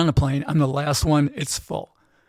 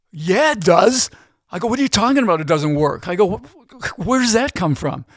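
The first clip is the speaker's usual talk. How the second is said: loud, high-pitched incredulous comeback; retold outraged protest, pitch jumping up; indignant retort; 5 semitones higher, 5 dB louder than usual. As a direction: fire back in loud disbelief, acting out an argument. Acted retort to being screamed at.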